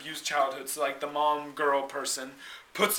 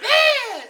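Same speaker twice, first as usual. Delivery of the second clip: high, loud imitated shriek; creature screech; 7 dB louder than usual. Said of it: "the creature goes".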